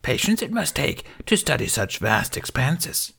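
put-on high, breathy little old master; lightly voiced Yoda. A narrator's reading. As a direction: croak it out like a tiny ancient sage. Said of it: "Yoda continued".

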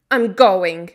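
loud, angry retort; shouted reply. A narrator's reading. Mary answering Colin's order, shouted angrily. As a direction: fire it back at full volume. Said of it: "Mary shouted in reply".

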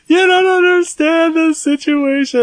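hammed-up tearful protest; mock whiny crying. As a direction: wail it like a sobbing nine-year-old. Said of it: "And it was so sad".

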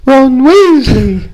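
very high, stretched-out name; drawn-out silly falsetto; mock character voice. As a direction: sing out the name on one high held note. Mimics the character's name for fun.

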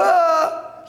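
high mock cry of alarm; startled cry. Preacher acting out disciples falling down, startled cry. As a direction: cry out as if suddenly struck with fear.

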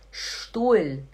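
"Stuhl" as shtool; initial sh. Shown as a demonstration. Teacher giving an ST example, "Stuhl" as shtool. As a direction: start "Stuhl" with a full sh. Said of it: "S T também faz som de".

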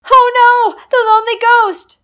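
high loud frightened scream. Acted. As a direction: shriek in terror. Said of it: "screamed Emma".

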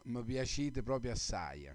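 Neapolitan accent, warm and fond; Neapolitan dialect. Real, not acted. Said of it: "ve lo dico in napoletano".

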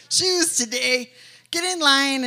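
loud, playful barked order; mock command. Preacher jokingly ordering the church into line, loud. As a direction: call it out like a drill sergeant, joking.

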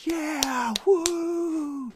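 high whooping cheer; a crowd whooping for a speech; mock cheering.

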